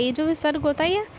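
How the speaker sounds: ordinary talk, nothing marked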